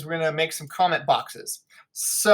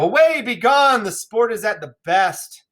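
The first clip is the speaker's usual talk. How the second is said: urgent Benvolio; 6 semitones higher, 4 dB louder than usual. Acted. Benvolio urging friends to leave.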